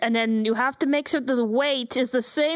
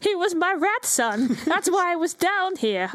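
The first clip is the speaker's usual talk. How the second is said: higher, slow pleading excuse; mock excuse; 8 semitones higher, slower than usual. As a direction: play innocent, stretched out and higher. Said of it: "be like".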